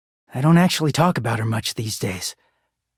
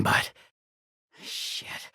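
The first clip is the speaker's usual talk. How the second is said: ordinary delivery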